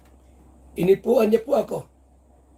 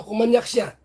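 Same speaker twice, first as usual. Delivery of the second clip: ordinary talk, nothing marked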